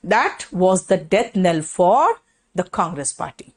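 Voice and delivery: very slow, word-by-word dictation of the definition. Shown as a demonstration. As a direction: dictate it so students can write. Teacher dictating example sentence. (धीमे-धीमे लिखवाना)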